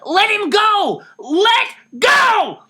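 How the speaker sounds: high, loud forceful yell; commanding shout. Acted. Pulling Dussel off the thief, loud shout. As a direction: order him off with all your force.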